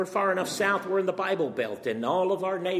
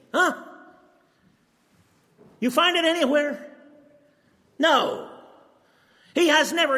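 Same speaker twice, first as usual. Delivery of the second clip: rising preaching fervor; 5 semitones higher than usual. Acted. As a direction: demand an answer from the room, voice climbing. Preacher challenges date-setting congregation.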